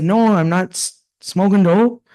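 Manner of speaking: retold teen denial; flustered denial. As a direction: defend yourself, pitch up. Denying to dad.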